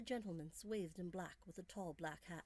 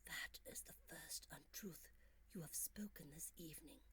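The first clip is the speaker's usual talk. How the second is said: whispered; 2 semitones lower, 8 dB quieter than usual. A narrator's reading. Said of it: "he whispered".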